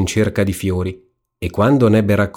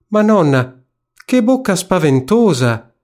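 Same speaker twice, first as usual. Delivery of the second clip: high-pitched storybook alarm; startled little girl's cry; 9 semitones higher, 3 dB louder than usual. A narrator's reading. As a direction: exclaim in rising dismay, much higher. Girl alarmed at wolf's mouth.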